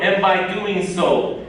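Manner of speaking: ordinary talk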